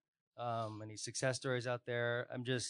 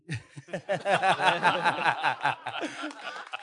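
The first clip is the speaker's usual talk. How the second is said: hearty laugh; 5 semitones higher, 7 dB louder than usual. Real, not acted. Laughs after pirate joke.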